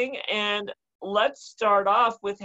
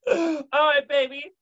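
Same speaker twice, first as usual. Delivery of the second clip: laughing; 7 semitones higher, 4 dB louder than usual. Real, not acted. Host laughing after story.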